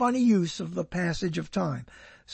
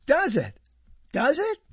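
ordinary talk

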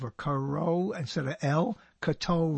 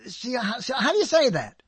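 pitched-up, fumbling mock question; mock puzzled voice; 7 semitones higher, faster than usual. Host mimicking people who ask how to say his name, raised pitch. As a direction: stumble over the question like someone baffled by a name. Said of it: "they go".

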